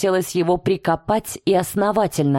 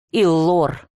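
growled. A narrator's reading. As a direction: growl the name in warning. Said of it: "прорычал".

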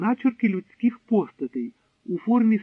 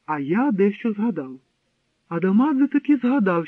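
plain reading voice

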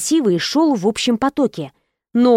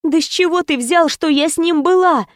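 higher, despairing question; a girlfriend asking in despair, pitched up; 7 semitones higher than usual. Acted. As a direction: ask in desperation. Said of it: "в отчаянии спросила я".

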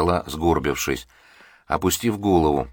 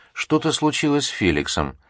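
higher, mildly anxious voice; worried; 8 semitones higher than usual. A narrator's reading. Liza tells the professor about Felix, mildly worried. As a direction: say it with a touch of alarm. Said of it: "обеспокоенно сказала она".

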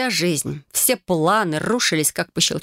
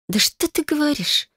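ехидно, голос сильно выше; поддеть мужа с насмешкой; 9 semitones higher than usual. Acted. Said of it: "ехидно выдала я". (ехидная насмешка, высоко)

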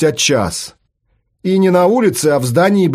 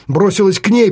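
ordinary delivery

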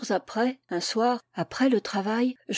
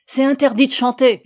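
loud, higher angry guard's order; yelling; 4 semitones higher, 9 dB louder than usual. A narrator's reading. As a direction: shout the ban at the prisoner. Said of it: "hurla le gardien". (hurlé avec autorité)

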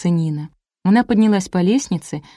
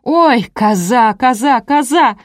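high, loud drawn-out cries; wailing yell; 5 semitones higher, 5 dB louder, slower than usual. A narrator's reading. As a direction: holler it slowly in alarm, over and over. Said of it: "завопила снизу Марина". (протяжный вопль)

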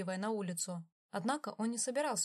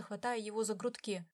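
plain reading voice

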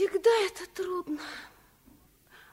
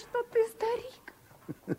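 slow, hushed whisper of worry; child whispering her fear to her father; 5 semitones higher, 4 dB quieter, much slower than usual. Acted. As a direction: breathe it out slowly, as a frightened secret. Girl worried about father dying.

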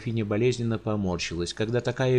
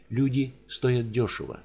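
usual speaking voice

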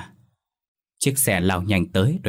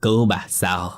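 ghost's bitter taunt; 3 semitones higher than usual. A narrator's reading. Ghost confronts grandma.

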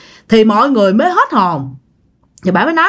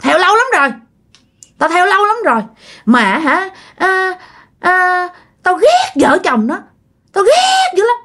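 high, bitter spiteful voice; jealous ghost; 7 semitones higher than usual. Retold or imitated. Ghost of the father envying the happy couple, spiteful and high. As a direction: spit out the envy. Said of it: "Nói kiểu vậy các bạn, ganh tị".

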